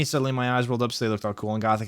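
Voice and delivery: plain reading voice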